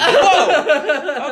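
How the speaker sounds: high, loud laughing whoa of shock; startled whoa. Retold or imitated. To her friend, mock shock as the music kicks in, laughing whoa. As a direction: yelp it out, caught off guard and amused. Sudden upbeat music after sad video.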